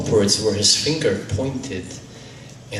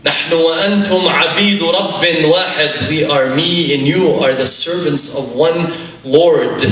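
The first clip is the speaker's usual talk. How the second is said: raised, forceful declaration to the mourners; preacher voicing a commanding visitor at full volume; loud proclamation; 6 semitones higher, 6 dB louder than usual. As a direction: proclaim it loudly, as a solemn truth. Visitor proclaims to the weeping family.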